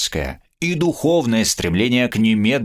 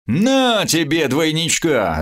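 joyful yell; 6 semitones higher, slower than usual. Acted. Somov gloats over the fallen Scandinavian, yelling. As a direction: crow it over the beaten foe. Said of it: "заорал радостный Сомов".

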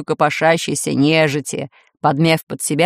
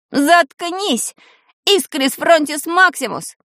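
high-pitched angry cry; shouting; 9 semitones higher, slower than usual. A narrator's reading. Enraged boy hurls a battle spell at Таня, shouting. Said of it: "закричал он".

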